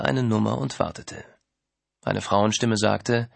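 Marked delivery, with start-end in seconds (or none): none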